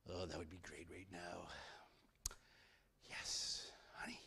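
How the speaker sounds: low hushed craving whisper; greedy whisper. Acted. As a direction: whisper it to yourself, hungry. Bear eyeing the beehive.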